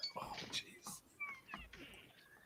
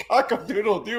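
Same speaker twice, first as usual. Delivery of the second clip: high crowing rooster call; 10 semitones higher than usual. Pretend. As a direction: crow it out like a rooster. Crowing in reaction to joke.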